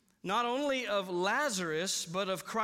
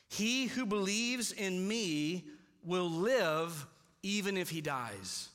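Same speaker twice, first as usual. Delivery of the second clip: very slow, weighty reading of the verse; slow scripture read; slow solemn reading; slower than usual. Shown as a demonstration. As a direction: let each word sink in. Reading the verse slowly first.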